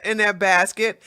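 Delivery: ordinary talk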